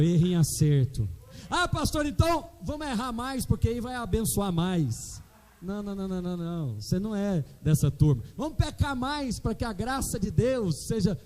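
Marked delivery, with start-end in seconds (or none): 1.5-5.2 much higher, mocking a naive member. Mocking imagined member's logic. 8.4-11.2 mock quote. Mocking imagined member.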